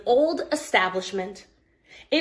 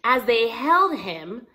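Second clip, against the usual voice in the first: ordinary delivery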